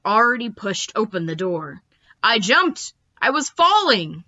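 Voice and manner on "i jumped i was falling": usual speaking voice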